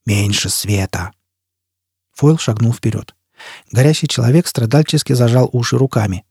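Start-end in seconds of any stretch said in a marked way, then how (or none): 0.0-1.1 low, slow hoarse plea; drawn-out low rasp; hoarse croak. A narrator's reading. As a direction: croak it slowly in pain. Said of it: "прохрипел он".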